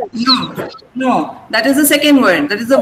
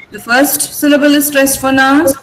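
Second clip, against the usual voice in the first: usual speaking voice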